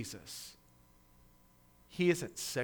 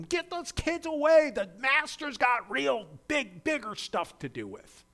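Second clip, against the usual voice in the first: disciples rebuking; 10 semitones higher, 4 dB louder than usual. Retold or imitated.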